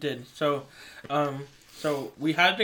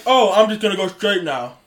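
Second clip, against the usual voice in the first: raised, loud mocking driver voice; mocked other driver; 5 semitones higher, 9 dB louder than usual. Retelling the near-crash, mocking the driver in a loud, raised voice. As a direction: make the driver sound oblivious, loudly. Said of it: "that's how he sounded like".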